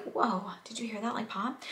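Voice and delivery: soft, hushed, half-whispered; hushed night voice. Retold or imitated. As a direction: hush it like it's late at night. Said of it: "I'm just like".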